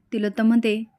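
ordinary delivery

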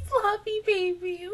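teary. Real, not acted. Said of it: "I didn't mean to cry".

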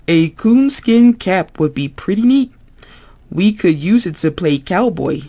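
put-on thrilled puppet kid imagining cowboys; Ernie voice. Ernie voice, excited.

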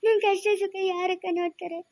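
acted sobbing lament with long tearful pauses; crying. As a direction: wail out your grief between sobs. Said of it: "ಅಳ್ಬಾರ್ದು ನೀನು".

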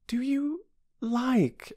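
mildly hesitant question; doubtful. Acted. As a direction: ask with a touch of misgiving, starting high. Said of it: "said Bobby doubtfully".

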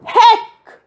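very high, loud yelp; sudden shout of alarm; sharp high exclamation; startled shout. A narrator's reading. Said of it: "She shouted".

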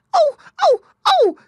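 very high, loud yelps of pain; an otter caught by the trap crying out. Acted. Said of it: "cried Little Joe Otter".

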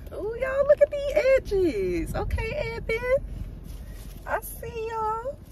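high, drawn-out playful squeal; high-pitched hype-girl cooing. Pretend. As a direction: squeal over it in a very high voice, stretched out. Hyping up her freshly laid edges.

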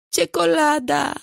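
put-on high, drawn-out toddler bawl; toddler yelling. A narrator's reading. Toddler demanding chocolate, yelling. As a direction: yell it slowly like a screaming small child. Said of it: "rozwrzeszczał się".

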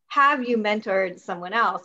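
ordinary delivery

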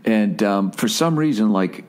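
usual speaking voice

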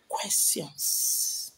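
hushed and breathless, almost a secret; whisper. Real, not acted. To her viewers, a sudden soft whisper. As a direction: drop to a whisper on "questions". Reminding viewers to ask questions.

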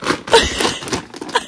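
breathless, voiceless laughing fit; delighted laugh. Real, not acted. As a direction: dissolve into soft laughter.

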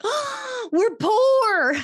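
hammed-up money panic, voice shooting up; mock panic. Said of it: "And I was like".

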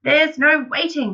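high, loud and excited; impatient cry. A narrator's reading. Father too worked up to wait, crying out. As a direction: brush the objection aside loudly. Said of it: "cried Mister Cranky".

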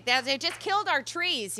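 usual speaking voice

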